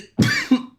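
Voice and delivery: short dry cough mid-sentence; real sick cough. Real, not acted. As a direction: break off into a cough. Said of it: "I'm losing my voice".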